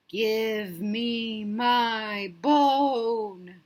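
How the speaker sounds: drawn-out eerie ghost moan, very slow; slow spooky ghost voice.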